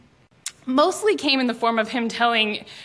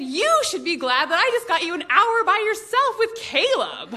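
higher, amused knowing retort; knowing mom retort; 8 semitones higher than usual. Retold or imitated. Mom teasing daughter over dinner, amused knowing retort. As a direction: tease her with the obvious. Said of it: "She said".

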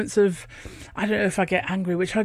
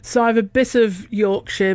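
usual speaking voice

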